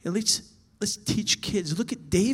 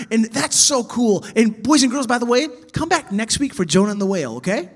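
put-on loud, higher, gushing kids' teacher; rushed Bible lesson; 5 semitones higher, 7 dB louder than usual. Shown as a demonstration. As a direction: race through the story all bubbly and cheery. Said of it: "Sometimes we teach Bible lessons like this".

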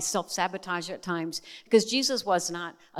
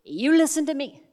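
higher and louder, a bold woman laying down the law; sassy angel scolding; 6 semitones higher, 5 dB louder than usual. Retold or imitated. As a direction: scold her like a sassy angel who won't take no. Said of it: "she leans on the table and she goes".

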